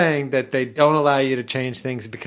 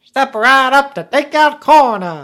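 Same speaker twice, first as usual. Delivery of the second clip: loud carnival barker; 10 semitones higher, 9 dB louder than usual. Pretend. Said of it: "more of a barker".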